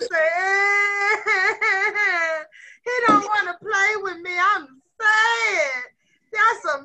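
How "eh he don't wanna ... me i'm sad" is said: loud, high pretend bawling; mock crying. Teacher showing pupils how a rejected friend feels, mock sobbing. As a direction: wail it like a hurt child, hammed-up. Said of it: "I would feel sad".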